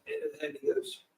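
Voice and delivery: ordinary talk, nothing marked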